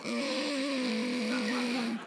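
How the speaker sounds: long, soft straining hum; reluctant strained hum. Retold or imitated. Scottish dad trying to support England, strained hum. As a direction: hum as if forcing words out.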